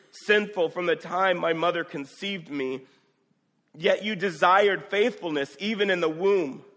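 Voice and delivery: fervent preaching; preaching the psalm. Acted. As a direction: proclaim each verse with fervour.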